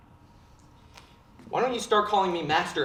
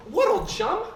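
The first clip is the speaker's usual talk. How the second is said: mock innocent question; 11 semitones higher, 7 dB louder than usual. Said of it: "and I'm all".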